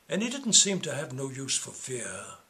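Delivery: ordinary delivery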